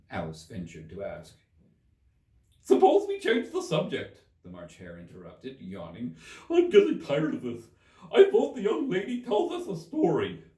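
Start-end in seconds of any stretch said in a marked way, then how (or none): 2.6-10.4 much higher, louder, bored hare voice; a yawning hare demanding a story; March Hare. Acted.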